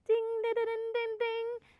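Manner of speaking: high chiming dings; mock bell. Teacher celebrating finding the larynx, high dings. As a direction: ring it like a game-show bell.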